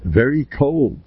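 ordinary talk, nothing marked

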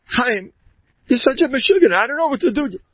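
high-pitched imitation of an exasperated wife; wife's scolding. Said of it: "His wife lets him have it".

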